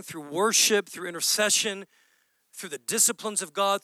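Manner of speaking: ordinary delivery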